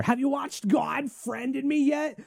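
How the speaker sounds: high, slow mock old lady asking; great aunt voice. Son mimicking his great aunt's nagging question, high and slow. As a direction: put on the fussy great aunt again. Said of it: "every time I go over".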